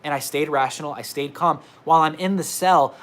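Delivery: usual speaking voice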